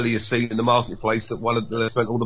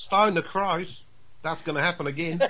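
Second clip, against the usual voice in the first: mock Ocker Aussie; 4 semitones higher, 6 dB quieter than usual. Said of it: "I thought".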